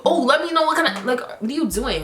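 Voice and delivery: high, prissy mock of a nosy girl; high-pitched catty imitation of the rude girl. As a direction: poke fun at her fake-sweet nosiness. Mocking a rude girl's comments.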